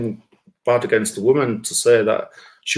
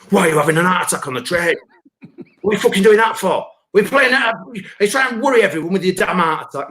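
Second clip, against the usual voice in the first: mock angry questioning; 6 semitones higher, faster than usual. To a heart attack victim on the train, a mock hectoring rant. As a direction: rant at the imagined patient.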